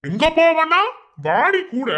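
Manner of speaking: high, loud menacing shout at her; a thug yelling at the girl to come with him; angry shout. Acted. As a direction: bark it at her to come along. Intruder demanding she come along.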